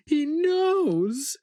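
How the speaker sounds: maniacal cry. A narrator's reading. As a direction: scream it like a man gone mad, not himself. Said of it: "in a voice too maniacal to be his own".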